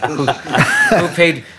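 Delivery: slow, high, laughing through it; laughing disbelief. Retold or imitated. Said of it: "and I'm like".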